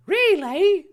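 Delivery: put-on delighted high, loud boy voice; squealed put-on excitement; Harry Potter. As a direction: exclaim it in hammed-up delight. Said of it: "Harry exclaimed delightedly".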